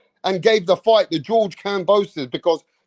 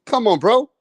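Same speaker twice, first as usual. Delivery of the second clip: ordinary delivery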